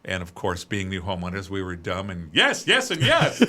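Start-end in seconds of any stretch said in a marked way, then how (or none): 2.3-3.4 laughing, higher and louder at own foolishness. Real, not acted. Laughing at how every upgrade got a yes. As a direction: yes squeezed out through a laugh.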